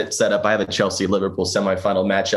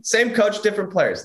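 higher, mocking singsong read of the quote; 10 semitones higher than usual. Shown as a demonstration. Host ridiculing a Tottenham coach, mocking higher voice. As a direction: make fun of the coach's excuse. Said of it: "let me make fun of Tottenham Hotspur".

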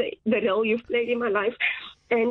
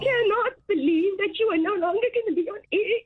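real tearful disbelief, pitched high; emotional, tearful; 7 semitones higher than usual. Real, not acted. Young journalist to departing radio host, tearful. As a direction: voice lifting as the tears come. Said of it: "I'm so sorry that I'm so emotional".

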